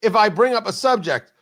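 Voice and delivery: ordinary delivery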